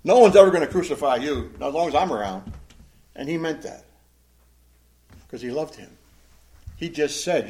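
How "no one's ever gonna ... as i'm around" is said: loud, firm insistence; protective rebuke. Acted. Peter rebuking Jesus because he loved him, protective insistence. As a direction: forbid it like a loyal bodyguard. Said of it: "He rebukes him".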